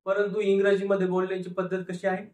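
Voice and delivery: ordinary delivery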